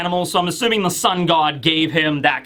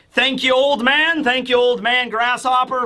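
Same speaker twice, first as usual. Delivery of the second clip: lightly voiced character, pitched up; acted polite thanks; 5 semitones higher than usual. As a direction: raise the voice for a put-on thank-you. Usopp voiced thanking the old man.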